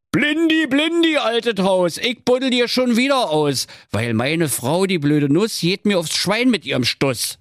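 high, sing-song calling; high-pitched pleading call in Berlin dialect; husband summoning Blindi. Acted. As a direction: chant the rhyme out to an old pal.

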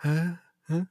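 imitating a confused guy. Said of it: "der hat immer so gemacht".

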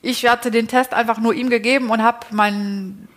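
normal talking voice

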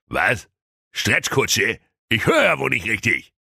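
a touch of outraged king; mock-outraged old king; mildly agitated king. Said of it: "rief König Blumenkohl erregt".

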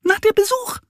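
tiny high mouse voices; tiny mice. A narrator's reading. Mice answering the bear, tiny high voices. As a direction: squeak it matter-of-factly. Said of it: "sagen sie".

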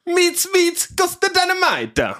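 very high squeaky shouted catchphrase; very high shouted catchphrase to open the show. Acted. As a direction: shriek it out like a cartoon character. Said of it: "Unser Mann mit der Neu-Catchphrase".

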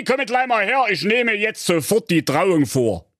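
hohe, aufgebrachte Königsstimme; wütender König. Acted. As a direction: schimpfender Märchenkönig, der seine Tochter zurechtweist. König ruft Tochter. (der König schimpft empört mit seiner Tochter)

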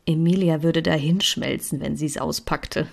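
ordinary delivery, nothing marked

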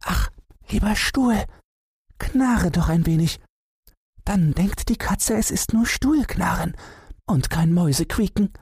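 high pleading mouse voice; a tiny mouse begging the chair in fear; high squeaky mouse voice, anxious; frightened little mouse. A narrator's reading. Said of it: "bat das Mäuseken in seiner Angst".

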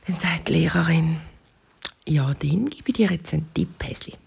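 hushed, unvoiced storytelling; whispered narration. A narrator's reading. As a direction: whisper the teacher's tip.

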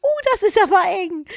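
high strangled puppy voice; gasping for air. Pretend. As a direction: squeeze it out like it's choking.

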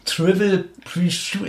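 "Trivial Pre-Shoot" langsam und stockend verstümmelt; an "Trivial Pre-Shoot" hörbar scheitern, die Silben gedehnt; verhaspelte Aussprache. Real, not acted. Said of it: "ich kann's nicht mal aussprechen". ("Trivial Pre-Shoot" mühsam falsch herausgewürgt)

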